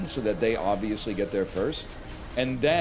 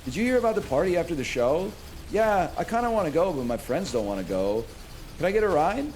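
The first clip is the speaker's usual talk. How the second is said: imitating the ladies; 7 semitones higher than usual. Streamer imitating young women asking for a ride, chirpy. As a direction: mimic a peppy young woman. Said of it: "They're going".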